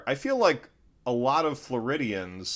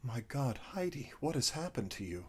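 put-on dropped Southern accent; 3 semitones higher, 11 dB quieter than usual. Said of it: "the scene would continue".

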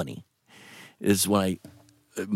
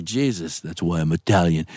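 ordinary delivery

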